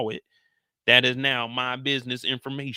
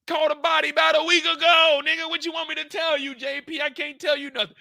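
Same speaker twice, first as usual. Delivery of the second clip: much higher, slowed-down yell; high mock yell; 5 dB louder than usual. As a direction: blow up at him, hammed up. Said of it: "Tommy's like".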